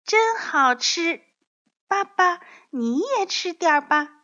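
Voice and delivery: put-on high, happy little child voice; delighted baby dinosaur. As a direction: offer it eagerly to daddy, pitched high. Baby dinosaur offers food to dad.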